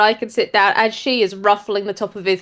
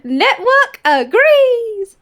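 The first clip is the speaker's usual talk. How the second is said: 1 eager; 11 semitones higher, 4 dB louder, much slower than usual. A narrator's reading. Narrator voicing eager spirit.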